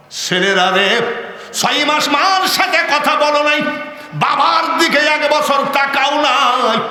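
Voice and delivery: loud chanting sermon delivery, high peaks falling back; full-force singsong preaching; impassioned preaching. Acted. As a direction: preach in a singsong wail, stretching line ends.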